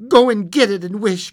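high, loud urgent command; excited cry. A narrator's reading. Wife orders husband to fetch paw, excited cry. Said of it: "cried the wife, quivering with excitement".

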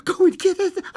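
very high, frantic cry; quivering excitement. A narrator's reading. Wife repeats her demand to husband, quivering excitedly. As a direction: shriek it, quivering, near sobs. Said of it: "quivering with excitement".

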